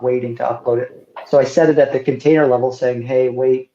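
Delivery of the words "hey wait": ordinary talk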